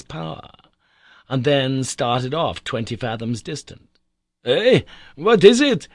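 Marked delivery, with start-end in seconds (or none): 4.4-5.9 put-on high, loud startled cry of an old professor; sudden loud alarmed uncle. A narrator's reading. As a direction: jolt awake and cry out in alarm. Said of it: "cried my uncle".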